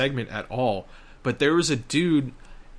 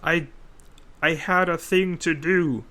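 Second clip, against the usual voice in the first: loud, higher mock-panicked voice; exaggerated belated realization, higher and louder; clueless developer impression; 6 semitones higher, 4 dB louder, slower than usual. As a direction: put on a slow, labored dopey voice. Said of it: "he'll be like".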